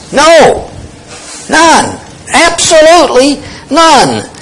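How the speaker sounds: NO, NONE, ABSOLUTELY NONE each high, falling hard, with pauses between; emphatic denial. Shown as a demonstration. Preacher showing how emphatic the verse is, emphatic NO and NONE. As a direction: pitch each negation way up and drop it with finality. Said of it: "he's emphatic".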